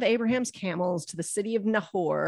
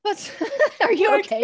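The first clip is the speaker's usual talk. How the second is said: laughing; 13 semitones higher, 6 dB louder than usual. Real, not acted. Laughing at partner.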